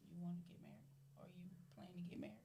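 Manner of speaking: very soft, trailing into a whisper; barely voiced, whispered pretend question; hushed mock question. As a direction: murmur the imagined question under your breath. Voices the question she would ask him.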